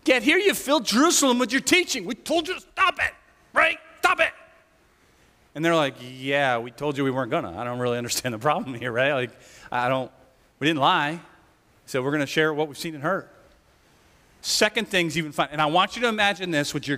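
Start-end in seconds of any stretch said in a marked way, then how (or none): 0.0-4.3 high, whiny scolding; whiny high priest. Retold or imitated. Priest whines at apostles. 6.0-13.3 calm nonchalant reply. Retold or imitated. Apostles answer calmly, unbothered.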